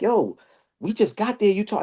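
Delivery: high, whiny we-just-got-here; mock the friend's complaint up high; protesting friend. Friend complaining they just arrived, high and whiny.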